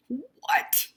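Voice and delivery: high, shocked squeak; shocked cry. Acted. Skunk shocked his trap failed, high squeak. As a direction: jump up in disbelief. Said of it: "cried Skunk".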